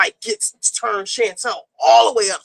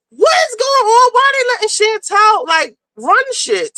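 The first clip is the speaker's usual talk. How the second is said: outraged disbelief; 9 semitones higher than usual. Real, not acted. Reacting to the reality show episode, outraged disbelief. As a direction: exclaim it in total disbelief.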